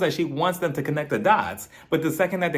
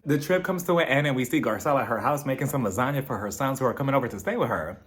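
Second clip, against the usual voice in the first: ordinary talk, nothing marked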